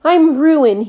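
high, loud despairing wail. A narrator's reading. The trader wails over his rotting potatoes, loud and despairing. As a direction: cry out at the spoiled potatoes. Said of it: "he wailed".

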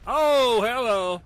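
loud, high burst of surprise; startled oh, easing into hello; surprised greeting. Real, not acted. Reacting to surprise on screen.

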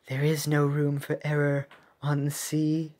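monotone, softly spoken sea captain; a subdued, even captain's voice; Monica voice. Acted. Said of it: "I'm gonna use that voice for Ice".

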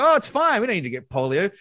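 high, loud mock-carefree brush-off; airy, exaggerated unconcern; breezy dismissal. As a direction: wave it away like a careless parent. Voicing a careless parent.

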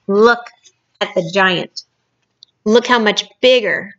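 plain reading voice

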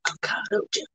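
whispered again; whispering. Pretend. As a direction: whisper it softly. Whispered repeat.

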